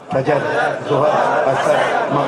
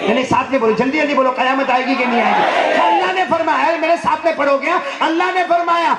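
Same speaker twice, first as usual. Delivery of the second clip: high, raised rallying voice; preaching at full force; 10 semitones higher, 5 dB louder than usual. Acted. Preacher urging the congregation to say it together, full force. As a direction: drive the crowd to answer together.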